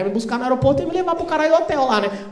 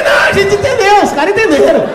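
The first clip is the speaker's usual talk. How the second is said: loud, high exasperated yell; high-pitched outburst of impatience; exasperated shout; 9 semitones higher, 7 dB louder than usual. Retold or imitated. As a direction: shout back at a repetitive announcement, laughter in it. Heckling the repeated pilot announcement.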